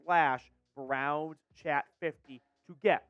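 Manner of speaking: smooth, even ad-copy read; sponsor ad read. Acted. Host reading the Factor Meals sponsor spot, ad read. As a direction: sell the meal service clearly.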